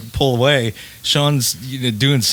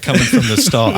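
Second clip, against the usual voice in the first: ordinary talk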